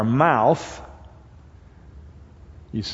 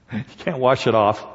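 ordinary delivery

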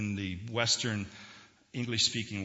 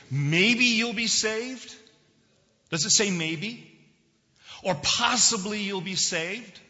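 loud, drawn-out incredulous question, pitched high; mock doubt; 8 semitones higher, 6 dB louder, slower than usual. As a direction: challenge the room with a rising, exaggerated question. Mock questioning of what scripture says.

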